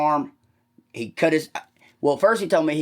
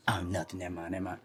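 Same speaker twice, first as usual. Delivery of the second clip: much softer, backing off; whispering; 6 semitones lower, 12 dB quieter, faster than usual. Retold or imitated. Billy retreating after being challenged, hushed. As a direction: drop to a hush and back away. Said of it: "he kept trying to whisper to me".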